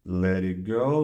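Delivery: ordinary talk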